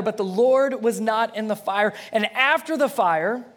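loud, high, building preacher's narration; dramatic scripture reading. A narrator's reading. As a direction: read the storm out big and forceful.

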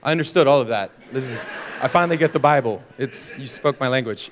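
lightly voiced millennial, pitched low; low mock-impressed aside; imitated millennial. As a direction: react like a young listener, low and amused. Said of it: "Some of you millennials, you're like".

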